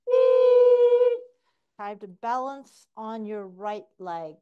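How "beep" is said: high loud timer beep; imitated beep. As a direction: mimic a shrill electronic beep. Imitating timer at sprint end.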